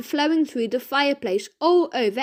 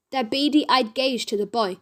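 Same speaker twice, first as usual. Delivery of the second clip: ordinary delivery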